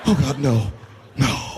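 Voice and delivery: hammed-up horrified protest; mock dread. Passenger ordered to obey hostage-taker, mock dread. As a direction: plead helplessly, as a scared victim. Passenger reacting to humiliating demand.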